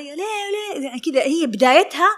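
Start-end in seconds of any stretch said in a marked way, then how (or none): 0.0-0.9 high-pitched mock protest; imitated attacking caller. Client attacking the dressmaker over the phone, high mock indignation. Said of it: "يعني ترفع السماعة علي".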